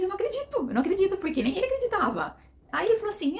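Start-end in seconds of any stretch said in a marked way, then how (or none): 0.0-1.2 high-pitched, amazed exclamation; astonished disbelief. Acted. Wife learning she'll work where husband works, astonished.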